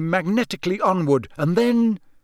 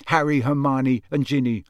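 usual speaking voice

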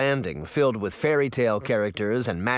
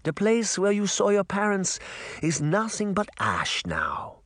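put-on high-pitched granny, light German accent, slowing at the end; 9 semitones higher than usual. Granny on the burned place, light German accent. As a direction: deliver bad news in a light German accent. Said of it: "in her light German accent".

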